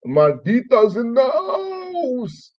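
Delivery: high, hugely drawn-out whoop; party holler. Retold or imitated. Recalling wives pounding drinks, high drawn-out party cry. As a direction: stretch "house" out long on a high held note.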